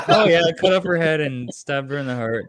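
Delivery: casual boast through laughter; laughing mock Van Helsing. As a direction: laugh while playing offhand Van Helsing. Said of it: "Van Helsing going".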